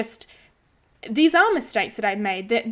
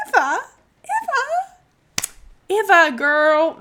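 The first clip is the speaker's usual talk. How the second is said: each "ever" punched louder, the middle one leaping way up; stress on each EVER; 9 semitones higher, 6 dB louder than usual. Acted. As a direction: stretch every "ever", each one bigger. Hyping the biggest mistake.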